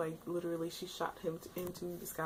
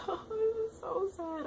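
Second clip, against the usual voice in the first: choked up, can barely get the words out; tearful; 4 dB louder, slower than usual. Real, not acted. Vlogger reliving a sad anime death, crying. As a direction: voice breaking high into a real cry. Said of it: "I really did tear up".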